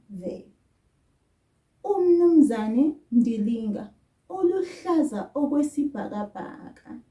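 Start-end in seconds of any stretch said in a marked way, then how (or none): 1.8-7.0 "UMnumzane" loud, with a clear "u" at the front. Shown as a demonstration. Teacher shows the name with u when not addressing him. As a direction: stress the opening "u" of "UMnumzane". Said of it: "I had u before his name".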